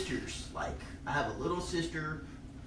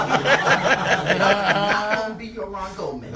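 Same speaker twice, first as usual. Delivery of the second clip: slow, loud, laughing delivery; refusing through laughter; laughing slow quote; 6 semitones higher, 11 dB louder, much slower than usual. Retold or imitated. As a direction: drag it out while laughing. Acted quote while laughing.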